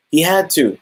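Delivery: usual speaking voice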